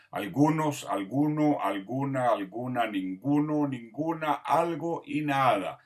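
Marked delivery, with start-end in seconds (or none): none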